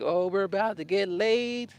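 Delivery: high, drawn-out mock-happy singsong; happy cicada. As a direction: stretch it out gleefully, voicing a happy insect. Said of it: "their sound is like a happy".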